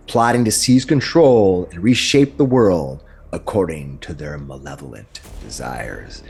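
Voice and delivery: grand fantasy narration over music; epic narration. Acted. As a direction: set the scene like a dark fantasy trailer. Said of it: "Did I oversell it?".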